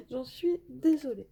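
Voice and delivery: rire gêné puis un pardon amusé; s'excuser en riant, un peu plus haut; excuses en riant. Real, not acted. S'excuse du bruit des oiseaux. (excuses rieuses pour les oiseaux bruyants)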